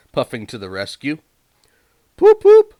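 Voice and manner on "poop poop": very high, loud whistle-like poop. A narrator's reading. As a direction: sing it out like an engine whistle. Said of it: "he whistled".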